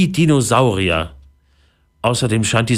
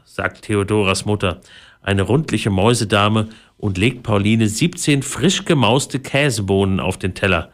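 ordinary delivery

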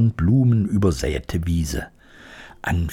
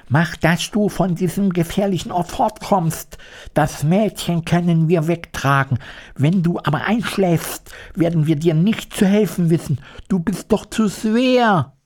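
much higher, pressing scarecrow voice; scarecrow urging; 9 semitones higher than usual. A narrator's reading. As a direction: hurry the lion away from danger. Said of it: "sagte der Scheuch zum Löwen".

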